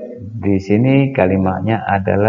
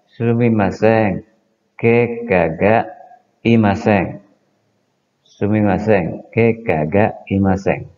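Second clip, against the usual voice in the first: Sumimasen, ke kaga imasen, drawn out slowly; slow model answer; slower than usual. Shown as a demonstration. Teacher modelling the negative reply for students: slow. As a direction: drill the polite no answer slowly. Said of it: "Kalau tidak".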